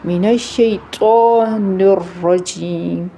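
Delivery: slow recitation with a heavy, full ط in "شیطان"; measured tajweed demonstration of heavy ط in "شیطان"; Quranic recitation, heavy ط. Shown as a demonstration. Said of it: "اس تا میں اور ژو میں فرق کرنا ہے".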